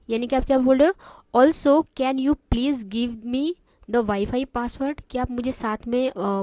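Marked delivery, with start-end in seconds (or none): 1.4-5.0 very slow, rising question; slow and clear. Shown as a demonstration. Teacher modelling the guest's full request slowly. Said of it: "मैं इतना आराम आराम से इसलिए बोल रही हूँ".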